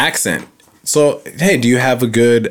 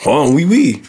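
French accent; slower than usual. Shown as a demonstration. Podcaster testing if Europe is fair game, French accent. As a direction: play a cartoon French speaker. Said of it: "No, I can do French".

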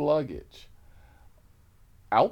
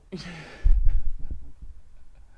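loud wheezing laugh; real laugh; 6 semitones higher, 8 dB louder than usual. Real, not acted. Storyteller cracking up as the police arrive, loud laugh. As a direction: burst out in a breath-heavy laugh.

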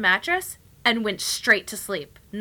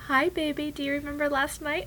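high sing-song greeting; cooing to baby; 8 semitones higher than usual. Retold or imitated. Mom waking her daughter after the outburst, cooing. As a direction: test the waters sweetly in baby talk.

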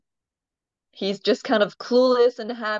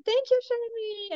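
very high, sweet llama voice; grateful llama voice; 4 dB quieter than usual. Retold or imitated. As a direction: thank the camel cheerfully. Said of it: "The llamas are like".

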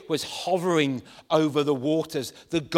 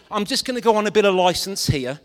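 ordinary delivery

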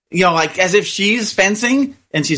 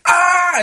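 mock bawling yell; 12 semitones higher than usual. Girl throwing off her mask after winning, mock crying yell. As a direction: let out the girl's sobbing victory yell. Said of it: "bawling and yell like".

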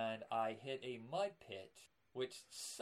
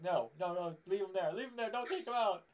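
mock pleading; 6 semitones higher, 5 dB louder than usual. Pleading with teacher in story.

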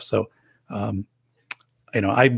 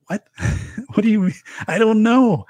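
baffled what; 12 semitones higher than usual. Retold or imitated. Imagined baffled reply about entropy.

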